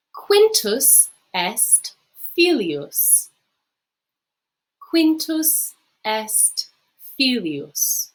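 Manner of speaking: slow, syllable by syllable, for the class to repeat; slow model reading. Shown as a demonstration. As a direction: read it out slowly so students can copy. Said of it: "practice saying it yourself".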